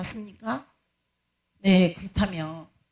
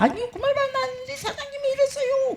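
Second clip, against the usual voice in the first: put-on young deacon crying out he did nothing wrong; whiny young deacon.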